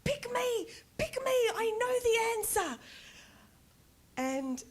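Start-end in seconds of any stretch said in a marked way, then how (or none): 0.0-2.8 high, loud, pleading pupil begging to be picked; imitating an over-eager schoolkid. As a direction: burst out like a child desperate to answer. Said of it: "I was the one in class going".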